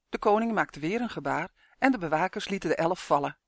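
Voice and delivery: ordinary delivery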